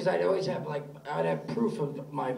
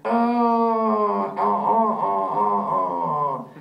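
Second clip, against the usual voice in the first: louder mechanical fake moans; fake moaning; 3 semitones higher, 5 dB louder, much slower than usual. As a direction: moan dutifully, clearly faked. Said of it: "I will pretend to fuck you now".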